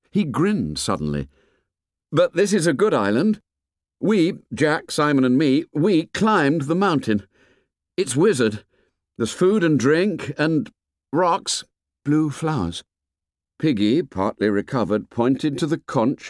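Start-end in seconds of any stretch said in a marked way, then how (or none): 2.1-12.8 put-on higher, eager boyish voice; enthusiastic boy. A narrator's reading. Ralph grins and praises the island, eager and higher. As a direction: list the delights with rising enthusiasm.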